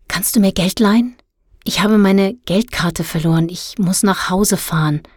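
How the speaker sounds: plain reading voice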